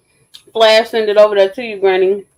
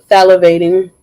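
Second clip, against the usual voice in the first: ordinary talk, nothing marked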